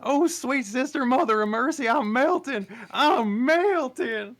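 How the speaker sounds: over-the-top dying wail; hammed-up melting panic. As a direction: wail like the witch melting in the rain. Donkey thinks rain melts him.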